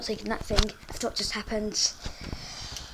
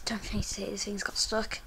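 whispering; 2 semitones lower, 9 dB quieter than usual. Real, not acted. Frustrated muttering in stuck elevator.

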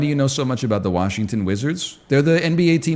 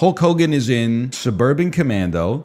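ordinary talk, nothing marked

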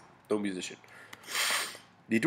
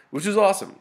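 excited; 11 semitones higher, 7 dB louder, faster than usual. Real, not acted. Excited about the Book of Asher.

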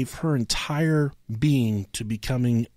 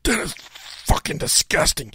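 disgusted; 4 semitones higher than usual. Retold or imitated. Spitting out a bad cookie.

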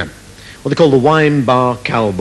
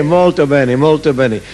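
mock Italian; 3 semitones higher than usual. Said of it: "Spanish, kraut, Italian garbage".

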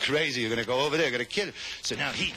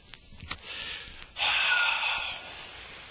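high breathy drawn-out exhale; long dying sigh. Acted. Dying soldier exhaling cigarette smoke, long mock sigh. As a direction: let out a last smoky breath.

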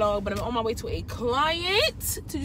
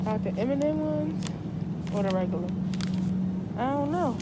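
muffled by cloth mask; speaking behind fabric covering; through a face mask; 3 semitones higher than usual. Real, not acted. Seen 3: Patterned cloth mask covers her mouth and nose throughout the labelled words.

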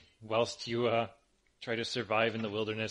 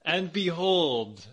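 grand announcement; 4 semitones higher, 5 dB louder, slower than usual. Acted. GM announces arrival, slow.

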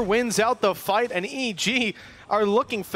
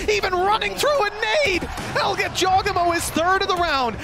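esports caster; 8 semitones higher than usual. Real, not acted. Caster calls clutch kill.

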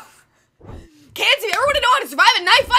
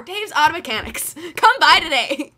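lower, salesman commercial voice; cheesy ad read; 6 semitones lower than usual. Acted. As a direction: pitch the auto shop like a local TV ad. Fake commercial sign-off.

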